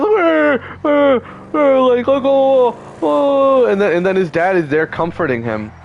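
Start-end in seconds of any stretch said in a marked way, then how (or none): 0.2-1.8 high-pitched wailing imitation of a crying boy; mock child crying. Retelling how the friend's son cried, mock wailing. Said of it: "then his son starts crying". 2.2-3.7 high, drawn-out mock sobbing wail; mock child crying. Retelling the son crying to his dad, mock wailing. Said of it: "then his son starts crying".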